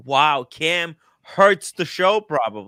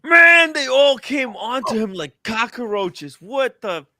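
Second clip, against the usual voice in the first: high, loud disbelief; real high-pitched outburst; shocked reaction; 8 semitones higher, 6 dB louder than usual. Real, not acted. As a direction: blurt it out in real shock at the sight. Shocked reaction to robbery footage.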